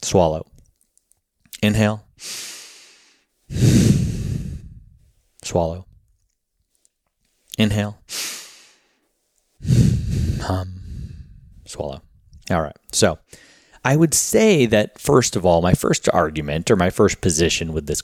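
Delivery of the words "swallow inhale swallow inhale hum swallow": guided breathing cues. Shown as a demonstration. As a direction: lead the listener through each breath gently. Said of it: "You can do it with me".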